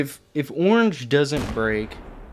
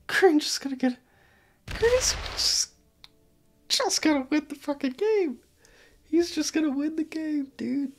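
much higher, squeaky disbelief; incredulous; 13 semitones higher than usual. Real, not acted. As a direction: pitch it way up in exasperated disbelief. Disbelief at Green winning.

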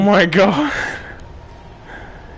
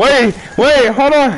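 panicked; 10 semitones higher, 5 dB louder than usual. Real, not acted. Panicking at teammate in game.

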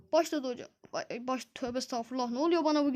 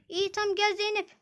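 ordinary talk

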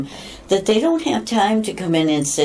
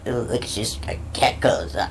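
mocking women; 6 semitones lower, 7 dB quieter than usual. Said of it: "the women will be like".

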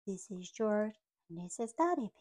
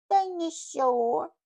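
slow, higher, louder whimpering word; tearful toddler; 7 semitones higher, 9 dB louder, slower than usual. Acted. George answering Officer Panda, slow tearful word. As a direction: plead the one word.

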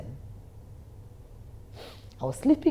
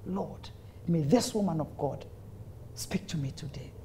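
hushed plea to God, trailing into near-whisper; pleading prayer; 2 semitones lower, 8 dB quieter than usual. Retold or imitated. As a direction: plead softly under your breath. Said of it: "I was pleading with the master".

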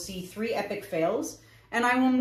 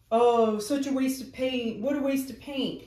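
mocking trolls; 4 semitones higher than usual. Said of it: "I get a lot of trolls on Facebook".

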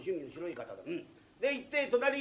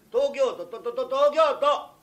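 東京都 stuck on と, ending in a drawn-out shout; stuttered 東京都; 6 semitones higher, 9 dB louder, slower than usual. Acted. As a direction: stammer 東京都 on と, then blurt it loud and high. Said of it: "東京と京都と切るからおかしくなっちゃう". (「東京都」を「と、と、と」と詰まり、高く大声で伸ばす)

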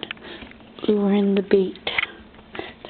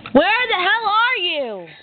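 high, drawn-out exasperated call; 9 semitones higher, slower than usual. Real, not acted. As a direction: call it out slowly, high and fed up. Calling out for hidden bee.

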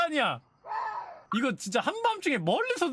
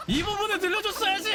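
indignant outcry; 9 semitones higher than usual. Real, not acted. Indignant complaint at quiz.

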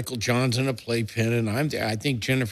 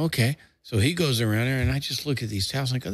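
ordinary delivery

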